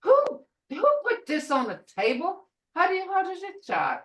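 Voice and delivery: high, quick questions; questioning grandma. Retold or imitated. Grandmother asking her grandson who made the mess, high questions. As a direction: quiz the boy lightly. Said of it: "I'll say".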